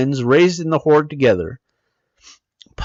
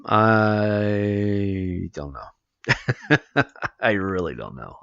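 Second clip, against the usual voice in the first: chuckling through it, low and soft; laughing; 6 semitones lower, 6 dB quieter, much slower than usual. Real, not acted. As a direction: laugh it off quietly. Laughs off having nothing to say.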